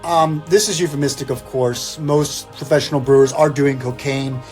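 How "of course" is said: normal talking voice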